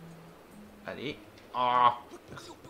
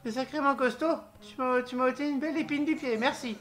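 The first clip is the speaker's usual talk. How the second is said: high, loud hammed-up character voice; grateful game creature; 9 semitones higher, 5 dB louder than usual. Game creature thanks hero for clearing enemies, high grateful voice. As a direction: play a high-voiced, impressed little creature.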